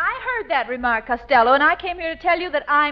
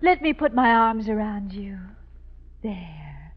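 tender comforting; 4 semitones lower, 4 dB quieter than usual. Acted. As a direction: soothe him like a sweetheart. Viola comforts Costello tenderly.

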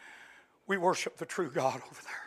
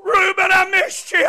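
tearful cry; 12 semitones higher, 13 dB louder than usual. Acted. Joseph weeping to brother.

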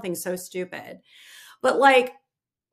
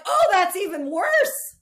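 high, slow, louder husband voice; mocking husband; 12 semitones higher, 7 dB louder, slower than usual. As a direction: play the teasing husband, drawn out. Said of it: "Mark's like".

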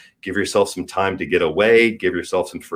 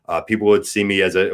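ordinary talk

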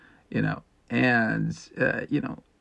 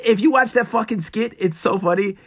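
ordinary talk

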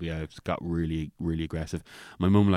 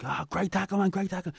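much higher, excited lads' cheer; mates cheering; 12 semitones higher, 3 dB louder than usual. Retold or imitated. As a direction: hype him up like a mate on the pitch.

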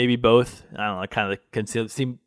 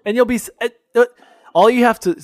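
mimicking Michael on the phone; 8 semitones higher, 5 dB louder, faster than usual. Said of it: "it's so funny".

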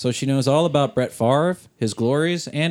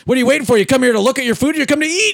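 pushy yelling ad; 9 semitones higher, faster than usual. Pretend. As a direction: bark at the customer to eat faster. Mock commercial, yelling at customer.

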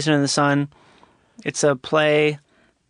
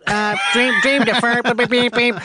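higher, chanting robot voice with beeps; 5 semitones higher than usual. Pretend. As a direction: beep it out like a poetry robot. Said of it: "So the robot goes".

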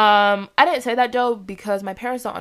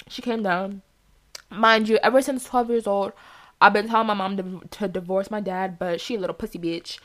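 ordinary delivery

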